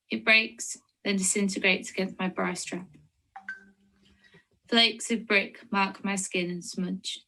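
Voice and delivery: measured poetry recital with long pauses; poem reading. Acted. Reads her poem aloud.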